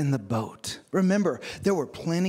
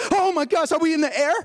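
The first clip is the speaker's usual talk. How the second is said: higher-pitched imitation of an anxious flyer; nervous passenger; 9 semitones higher, 4 dB louder than usual. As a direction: act out a panicky young passenger. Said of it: "he's nervous and anxious".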